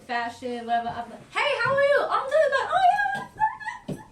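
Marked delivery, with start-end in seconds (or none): 1.3-4.0 much higher, louder, laughing party voice; hyped bartender greeting. Retold or imitated. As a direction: greet them like the bar is lit. Acting her bartender greetings.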